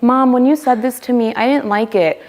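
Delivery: lightly voiced kid confronting mom; a hint of an earnest child; child speaking up. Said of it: "when they were like".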